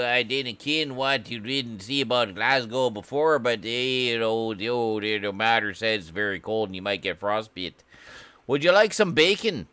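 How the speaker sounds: over-the-top Scottish brogue; mock Scottish accent. Continues Scottish voice.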